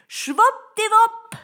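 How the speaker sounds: very high, loud, drawn-out yell; shouted magic word. A narrator's reading. Boy shouts the magic word to break the spell, shrieking. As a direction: yell it slowly, right up high. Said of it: "schrie Peter".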